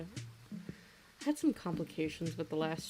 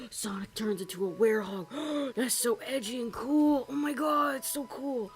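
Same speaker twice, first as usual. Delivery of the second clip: gasping, higher-pitched mock excitement; breathless imitation of starstruck hype; excited fangirl gushing; 5 semitones higher than usual. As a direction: gush like a thrilled young fan reliving it. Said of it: "and then I was like".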